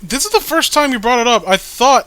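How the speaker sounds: ordinary talk, nothing marked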